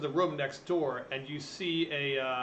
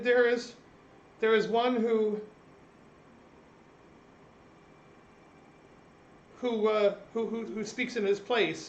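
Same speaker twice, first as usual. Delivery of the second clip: higher, fast, stammering servant voice; nervous servant; 7 semitones higher, 5 dB quieter than usual. Acted. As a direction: stutter it out, flustered and anxious.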